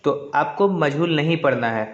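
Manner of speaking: ordinary talk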